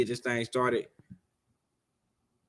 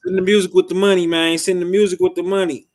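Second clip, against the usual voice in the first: shouting; 8 semitones higher, 10 dB louder than usual. Real, not acted. Host urging listeners to send music.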